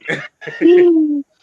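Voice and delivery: explosive high-pitched laugh; loud high burst of laughter. Real, not acted. Nephew laughing at his uncle's blunt answer, loud burst of laughter. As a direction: crack up loudly at the punchline.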